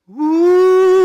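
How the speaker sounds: long, high, loud ghost wail; mock haunting howl; spooky "ooh". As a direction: play the ghost with a drawn-out "ooh". Dad playing spook in the dark.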